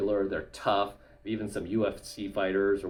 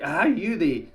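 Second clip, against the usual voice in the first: mock heckler; 7 semitones higher, 5 dB louder, slower than usual. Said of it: "I get called out a lot".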